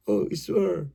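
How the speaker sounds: mild plaintive call, softly acted; a touch of lament; mock crying. Poem's man calls on Ishwar, mildly crying out. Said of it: "he cried".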